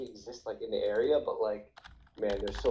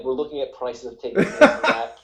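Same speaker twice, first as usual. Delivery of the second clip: laughing; 11 dB louder than usual. Real, not acted. Laughing while retelling ticket prices.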